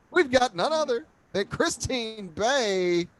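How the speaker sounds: announcer intro. Real, not acted. Host announces guest on show.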